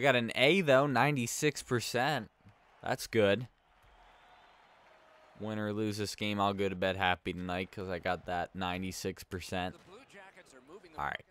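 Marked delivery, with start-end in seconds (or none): none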